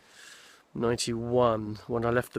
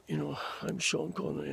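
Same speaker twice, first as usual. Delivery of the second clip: put-on a softer, worn older Connery voice; older Sean Connery; 4 semitones higher, 6 dB quieter than usual. Shown as a demonstration. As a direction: do Connery later in life, voice gone a bit. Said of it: "slightly older 'cause his voice has gone a bit".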